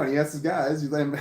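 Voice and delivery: ordinary talk, nothing marked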